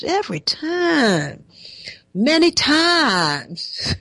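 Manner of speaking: high, slow mock southern twang; imitating a southern twang. Host mocking the candidate's southern accent. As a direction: drawl it out in a put-on southern accent. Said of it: "southern twang in the voice there".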